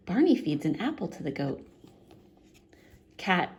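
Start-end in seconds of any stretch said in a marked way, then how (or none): none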